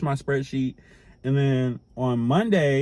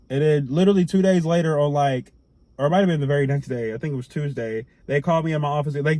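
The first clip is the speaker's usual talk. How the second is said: ordinary talk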